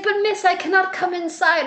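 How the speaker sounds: put-on high, prim old-fashioned ghost voice; lightly voiced mournful widow. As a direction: speak like a proper colonial ghost. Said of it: "she's like".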